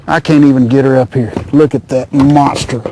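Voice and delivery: normal talking voice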